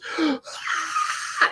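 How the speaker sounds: sharp shocked breathless gasp; imitated gasp of a frightened mom; mom's gasp. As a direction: gasp in horror like a startled mother. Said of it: "her mom goes".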